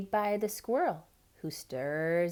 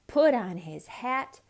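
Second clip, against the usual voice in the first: ordinary delivery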